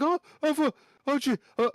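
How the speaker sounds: high breathless stammer of relief; flustered gasps. Acted. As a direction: squeak out the near-curse. Boy panting after near fall.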